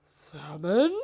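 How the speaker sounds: loud rising squawk; a pelican startled by joy; loud incredulous cry; screeching pelican. A narrator's reading. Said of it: "screeched the pelican".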